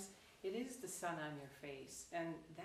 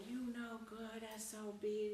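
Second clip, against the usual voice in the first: higher-pitched scolding, not truly shouted; mock screaming abuse; 4 semitones higher than usual. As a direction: hurl insults in a put-on voice. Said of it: "it's screaming profanities at him".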